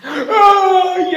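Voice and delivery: chillido agudo de llanto; grito de monstruo. Acted. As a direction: grita ofendido. (monstruo chilla dolido)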